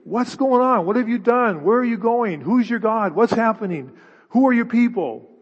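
panicked sailors interrogating Jonah; frantic sailors. Acted. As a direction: pepper him with questions. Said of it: "and they believe it".

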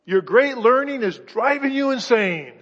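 mock Paul as Festus; loud interruption. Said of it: "Festus interrupts him and says".

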